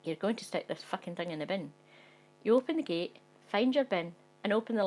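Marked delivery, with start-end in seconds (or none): none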